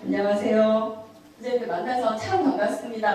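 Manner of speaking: clear, audible greeting at full voice; easy-to-understand demo. Shown as a demonstration. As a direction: greet them so every word is understood. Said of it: "선생님들 좀 많이 잘 알아들으시잖아요". (잘 알아듣게 또렷이 말하는 인사)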